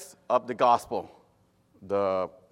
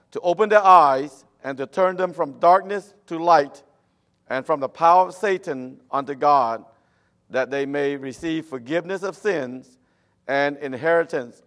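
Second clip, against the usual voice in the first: preaching scripture; 2 semitones higher, 5 dB louder than usual. Acted. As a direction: proclaim the verses at full force. Continues the scripture reading.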